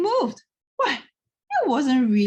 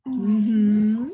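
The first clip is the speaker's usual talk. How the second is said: agreeing librarian; 8 dB louder than usual. A narrator's reading. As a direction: hum a gentle yes. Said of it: "she agreed".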